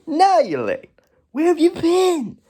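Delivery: high put-on scolding voice; character impression. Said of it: "That's literally how he talks".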